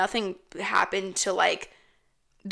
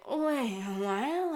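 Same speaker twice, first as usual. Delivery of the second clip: put-on drawn-out, higher slurred drunk voice; drunk mom; 4 semitones higher, 4 dB quieter than usual. Drunk mom bewildered on a phone call, mock slurred. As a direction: play a tipsy mom who can't follow. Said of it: "my mom's fucking drunk".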